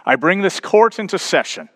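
high, declaring courtroom voice; judge. Acted. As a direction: proclaim it as a judge opening court. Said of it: "You can almost hear the judge".

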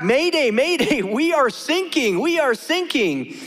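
high, slow-drawn mayday plea; repeated mayday from a sinking ship; distress call. Acted. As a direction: call for help over the radio, acted out. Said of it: "And they answer back".

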